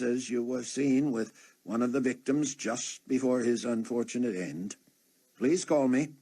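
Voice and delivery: polite but pointed inspector on the phone; police captain voice. Acted. Scotland Yard captain's phone message.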